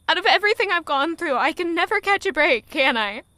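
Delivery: rising to a loud, high cry; anguished outburst. Acted. Heroine crying out her despair alone, anguished shout.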